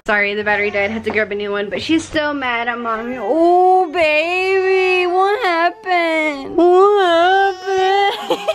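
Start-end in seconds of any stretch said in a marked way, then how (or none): 3.2-8.5 cooing sympathy. Real, not acted. Mother to her crying baby, slow high sympathetic coo. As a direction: coo soothingly, stretching every word.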